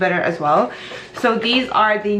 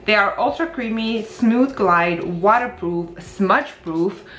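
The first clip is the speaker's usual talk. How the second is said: ordinary delivery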